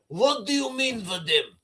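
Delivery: a lightly voiced Putin, asking in a Russian accent; mock Russian Putin. Putin questioning his aide, in a mock Russian accent. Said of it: "He's like".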